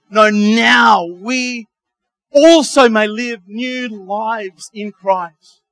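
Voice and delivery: "now we also may live new lives" said very slowly, word by word; slow right down and lean on "now"; slow emphatic. Acted. Slowed repeat of the scripture.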